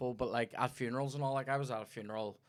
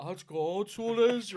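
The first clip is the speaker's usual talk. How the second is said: higher, lightly hammed-up churchgoer in awe; mock reverent awe; 9 semitones higher, 7 dB louder than usual. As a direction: gush at the music like it's heavenly. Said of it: "you're sitting there going".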